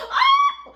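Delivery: short, very high, loud "ah!"; mock gasping scream. Storyteller shocked that the roof is hit, mock scream. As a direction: cry out in staged alarm. Flame lands on new house.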